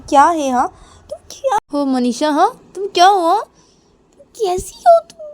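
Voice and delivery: high, loud, exasperated shouting; angry outburst. Acted. Nisha snapping at Manisha on the way to her room, angry and loud. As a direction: act out a furious, high-pitched tirade. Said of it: "ऐसे गुस्से में".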